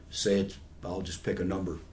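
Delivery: normal talking voice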